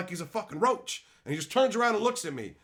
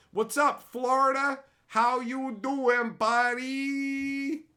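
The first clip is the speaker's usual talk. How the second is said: high, sing-out hello; pitched-up, drawn-out holler; called-out greeting; 8 semitones higher, 4 dB louder, much slower than usual. Real, not acted. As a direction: hail a buddy with a long stretched ending. Calling out a greeting.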